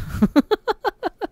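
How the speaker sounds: very high squeaky laugh. Real, not acted. Laughing off his rant about comments. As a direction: burst into a high giggle.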